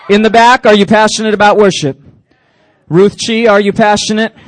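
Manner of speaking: loud, rising call to the crowd. Real, not acted. Youth pastor calling out to the crowd, loud and rising. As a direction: shout it to the back row. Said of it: "calls out to crowd repeatedly".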